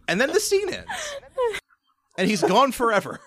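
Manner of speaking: high-pitched, laughing through the words; real laughter breaking into a retelling; cracking up. Real, not acted. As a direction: barely get it out for laughing. Cracking up over a retold joke.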